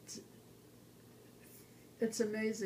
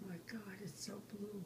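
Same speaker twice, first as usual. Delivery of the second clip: hushed whisper of wonder; awed whisper; 9 dB quieter than usual. Retold or imitated. Survivor looking at the sky, whispering in awe. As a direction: breathe it softly in awe.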